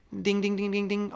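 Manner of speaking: high, even, rapid dings; imitated alarm bell. Disciples suddenly recognise who is on shore, imitated high dinging. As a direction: chime like a bell going off.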